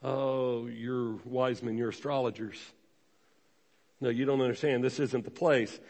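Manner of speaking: low, soft, condescending brush-off; dismissive church folks. Retold or imitated. Imitating church folks turning the wise men away, soft and dismissive. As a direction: turn them away politely but coldly. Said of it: "how church folks would respond".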